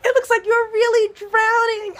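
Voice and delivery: very high, loud laughing taunt; laughing kids. Retold or imitated. Toddlers laughing at their sinking counselor, giggling taunt.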